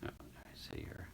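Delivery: whispered to himself while fiddling; whisper, checking the device. Real, not acted. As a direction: breathe it out under your breath.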